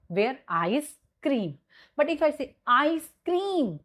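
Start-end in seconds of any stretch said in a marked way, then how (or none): 0.5-1.6 ICE CREAM slowly, split by a pause; slow, separated ICE ... CREAM; juncture pause. Shown as a demonstration. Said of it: "this is your juncture". 2.7-3.8 ICE CREAM high, heard as "I scream"; high, emphatic ICE CREAM; juncture demo. Shown as a demonstration. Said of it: "that means I scream".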